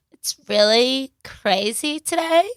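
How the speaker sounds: put-on slow, drawn-out Jersey accent; Teresa Giudice impression. Trying out a Teresa voice for a friend, Jersey accent. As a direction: stretch "it's really crazy today" in a Jersey accent. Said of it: "So, dolls".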